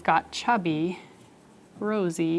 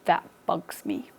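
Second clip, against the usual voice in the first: lower, softer grumbling tough guy; grumpy letter U; 4 semitones lower, 7 dB quieter than usual. Acted. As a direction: grumble it like a grouchy bully. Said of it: "And he said".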